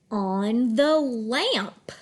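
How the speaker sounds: slow, connected "on the lamp"; lilting "on the lamp", rising then falling; smooth phrase reading. Shown as a demonstration. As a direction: stretch "on the lamp" into one flowing phrase. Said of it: "read smoothly in phrases".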